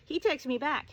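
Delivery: ordinary talk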